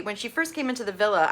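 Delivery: usual speaking voice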